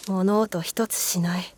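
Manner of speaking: usual speaking voice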